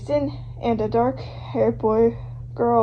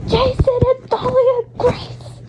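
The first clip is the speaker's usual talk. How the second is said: higher still, slow and breathless; even more stunned; 11 semitones higher than usual. Acted. Fan repeating the names, even higher in shock. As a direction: lift it into disbelief.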